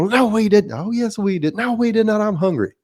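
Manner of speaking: put-on childish sibling squabble; bickering old brothers. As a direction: play two old men bickering like kids. Brothers bicker back and forth.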